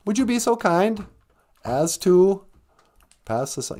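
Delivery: lilting, high courteous request; polite Norwegian way. Shown as a demonstration. Mimicking polite Norwegians asking for salt, high lilting. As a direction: ask ever so politely, rising. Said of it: "That's how they speak in Norway".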